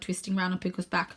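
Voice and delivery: usual speaking voice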